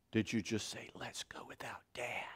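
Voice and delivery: whispered in wounded disbelief; hushed, wounded whispered question; hurt whisper. Acted. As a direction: whisper it like a left-out little kid. Acts out hurt inner five-year-old.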